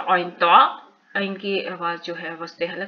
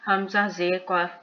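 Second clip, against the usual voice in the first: normal talking voice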